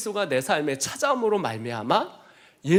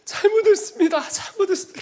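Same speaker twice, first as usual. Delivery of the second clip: much higher, sobbing apology; crying repentance; 13 semitones higher than usual. Retold or imitated. Man beating the floor, crying to God for forgiveness. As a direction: keep breaking down on each sorry. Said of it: "제 발을 쳐면서".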